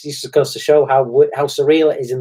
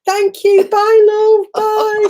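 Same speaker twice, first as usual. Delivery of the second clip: much higher, louder farewell shout across the forecourt; calling goodbye; 6 dB louder, much slower than usual. Retold or imitated. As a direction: shout thanks as the car drives off. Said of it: "they're shouting".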